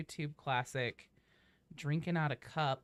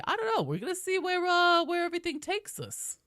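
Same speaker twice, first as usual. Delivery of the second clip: put-on high, quavering old lady voice; 13 semitones higher, 3 dB louder than usual. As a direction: play a chirpy old granny. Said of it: "The old lady voice".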